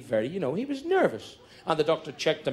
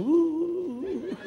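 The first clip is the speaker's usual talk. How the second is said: high swooning ooh. Retold or imitated. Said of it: "She's going".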